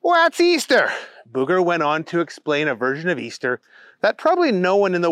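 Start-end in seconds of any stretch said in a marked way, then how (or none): 0.0-0.9 very high, loud cartoon buddy voice, drawn out slowly; high goofy friend agreeing to help; Keith's goofy voice. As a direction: squeal it like an eager cartoon sidekick. Keith figure in story.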